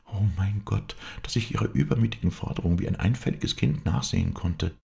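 high-voiced lamenting wife; a wife bewailing her own gullibility; distressed wife.

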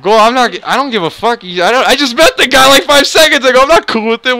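high, worked-up retort; indignant protest. Retold or imitated. Teller objects to a stranger acting cool with him, indignant. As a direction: protest it with rising exasperation.

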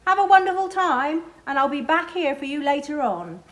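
calling out high and loud across the water; cheerful call. A narrator's reading. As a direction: shout a happy goodbye from the boat. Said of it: "Skipper waves goodbye and calls".

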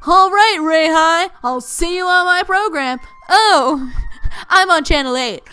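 put-on cartoon presenter; chatty sprite plugging a program; animated game-character read; TV-host sprite. Voices TV sprite's dialogue.

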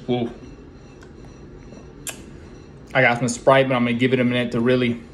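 muffled behind fist; reacting to spicy heat; hand over mouth. Real, not acted. Seen 1: Fist pressed to lips from 2.7s on; no chewing clearly seen.

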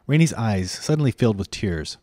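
ordinary delivery, nothing marked

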